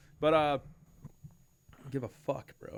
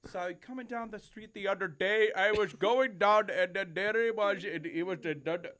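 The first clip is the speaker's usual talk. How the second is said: put-on high, loud British accent falling apart; bad British accent; 8 semitones higher, 5 dB louder than usual. Imitating a coworker's failed accents, botched British accent. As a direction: start British, slip towards Middle Eastern. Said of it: "I'm gonna do British".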